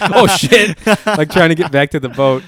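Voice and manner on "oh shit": loud, high yelp of alarm; mock panic, blurted high and loud; retold freak-out. Storyteller voicing his friend as the boat drifts away, retold freak-out. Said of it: "he said he, like, freaked out for a second".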